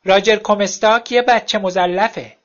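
slow, loud shout up at the window. A narrator's reading. Ex-wife shouting through the window at night, slow loud yell. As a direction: yell it slowly for the whole street. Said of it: "و فریاد زد".

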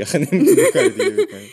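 high laughing teasing. Real, not acted. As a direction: laugh through the jab. Teasing joke, bursts out laughing.

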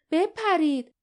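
high, sharp shouted order; roared command. A narrator's reading. Barda orders companions to jump from danger, high urgent shout. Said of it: "باردا غرید".

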